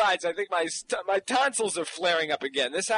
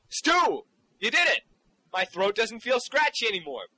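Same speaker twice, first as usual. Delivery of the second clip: thrilled; 6 semitones higher than usual. Acted. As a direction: cry out with joy at the cure. Cured, thrilled.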